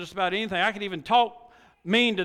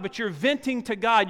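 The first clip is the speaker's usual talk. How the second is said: ordinary talk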